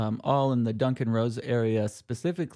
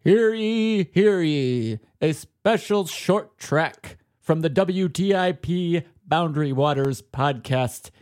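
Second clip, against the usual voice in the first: slow booming proclamation; town crier; 5 semitones higher, 5 dB louder, slower than usual. Acted. Mock proclamation intro.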